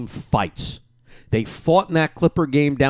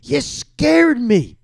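startled wife; 9 semitones higher, 3 dB louder, much slower than usual. Retold or imitated. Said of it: "It was like".

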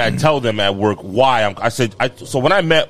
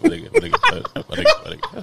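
laughing greeting. Real, not acted. Hyping up a friend, laughing.

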